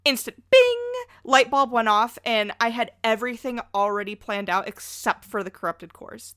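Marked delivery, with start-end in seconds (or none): 0.0-0.8 high, loud ringing "bing"; bright-idea ding. Retold or imitated. Host retells sudden inspiration, imitated ding. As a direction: punch out a sudden high chime.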